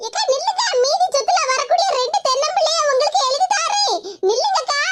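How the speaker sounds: high, rising call to stop her; calling after. Acted. Younger sister calls after the fleeing elder sister, pleading. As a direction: shout after someone running off.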